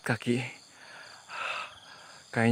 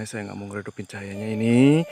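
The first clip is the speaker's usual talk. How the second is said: ordinary talk, nothing marked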